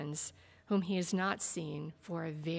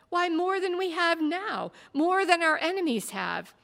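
higher, louder insistence; insistent; 10 semitones higher, 8 dB louder than usual. A narrator's reading. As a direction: push the point hard. Warrior answers insistently.

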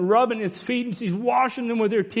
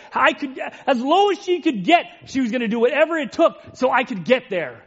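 raised, higher pulpit voice building with feeling; fervent sermon delivery; impassioned preaching; 6 semitones higher than usual. Acted. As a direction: preach it out with fervour, climbing. Preacher builds to full force.

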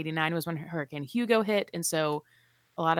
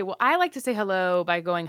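usual speaking voice

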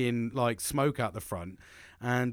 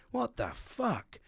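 high, softer, drawn-out incredulity; retold disbelief; 9 semitones higher, 5 dB quieter, much slower than usual. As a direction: stretch it out, baffled. Retelling his reaction to complaint.

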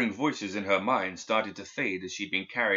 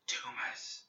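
slow, breathless whisper from far off; ghostly whisper; 10 dB quieter than usual. A narrator's reading. Dead student's voice reaching Perdita's mind, ghostly whisper. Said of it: "it said".

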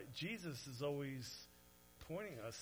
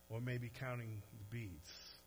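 ordinary talk, nothing marked

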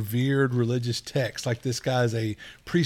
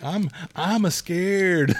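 put-on higher, slow, childlike scared voice; hammed-up little fright; mock scared; 6 semitones higher, much slower than usual. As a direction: play at being frightened, drawn out. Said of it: "I'm like".

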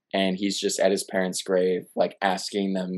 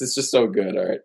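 normal talking voice

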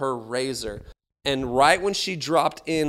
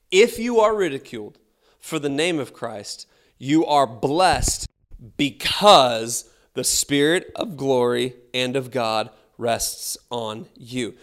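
slow, loud scripture reading; preaching scripture; 6 dB louder, slower than usual. Acted. Preacher reading First Peter to church, slow loud proclamation. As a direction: proclaim the verse slowly and loudly. Reads scripture at full force.

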